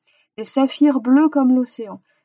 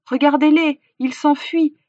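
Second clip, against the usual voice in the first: raised, higher voice calling attention; urgent warning; 5 semitones higher, 6 dB louder than usual. A narrator's reading. Hunter showing villagers the fleeing birds, urgently warning. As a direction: call out so the crowd looks.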